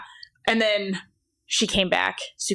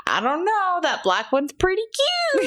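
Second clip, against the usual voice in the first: put-on high child voice; 11 semitones higher than usual. Child coy about puppy.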